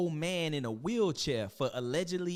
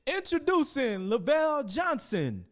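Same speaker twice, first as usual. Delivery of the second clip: much higher, slow grand announcement; mock announcer; 12 semitones higher, 4 dB louder than usual. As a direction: announce him like a herald at court.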